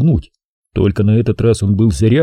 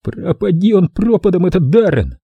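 high lisping curse; lisping whistling voice; 8 semitones higher than usual. Acted. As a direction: spit it out in a high lisp. Tommy's voice continued.

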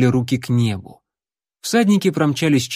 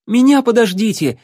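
high, loud call after the others; 10 semitones higher, 5 dB louder than usual. Acted. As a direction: shout to not be left behind. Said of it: "крикнул Алан". (Алан кричит вслед уезжающим)

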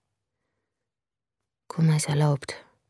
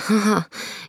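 a quick rueful laugh; short bitter laugh; 5 semitones higher, 3 dB louder than usual. Acted. Narrator scoffing at the beginner yoga class, short laugh. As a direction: laugh at yourself, wryly.

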